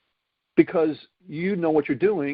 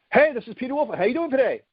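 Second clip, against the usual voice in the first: bright, lively phone greeting, pitched much higher; energetic inflection; 7 semitones higher, faster than usual. Shown as a demonstration. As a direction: greet them with real energy in your voice. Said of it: "You hear the difference?".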